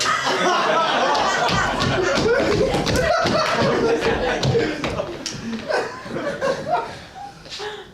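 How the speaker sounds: drawn-out sinister high laugh; long high clown cackle. Acted.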